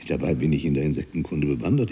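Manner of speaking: usual speaking voice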